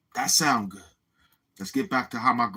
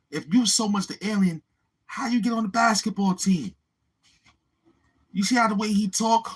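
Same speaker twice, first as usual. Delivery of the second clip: ordinary talk, nothing marked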